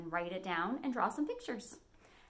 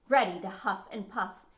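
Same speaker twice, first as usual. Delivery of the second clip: ordinary delivery, nothing marked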